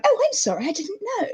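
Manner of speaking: high, soft posh little voice; posh child. Retold or imitated. Posh newcomer apologising to a Bromley kid, high posh accent. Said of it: "I lost my posh accent".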